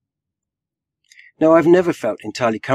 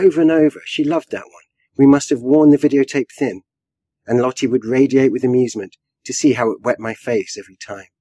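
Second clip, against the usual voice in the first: plain reading voice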